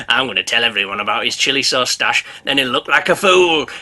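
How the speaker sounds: low scheming inner voice; sly shark voice. Acted. Shark's imagined thoughts.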